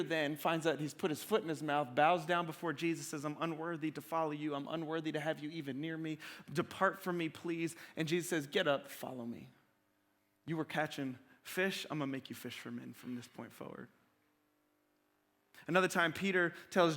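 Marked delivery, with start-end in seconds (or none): 3.2-7.8 soft, low, ashamed plea; bow your head and murmur it; humble and hushed. Retold or imitated. Peter humbled, bowing before Jesus. 8.5-13.9 soft and low, kind invitation; Jesus calling the kneeling fisherman, soft and low; gentle call. Retold or imitated. Jesus retold, gently calling Peter.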